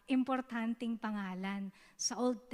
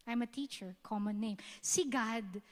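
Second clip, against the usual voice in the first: normal talking voice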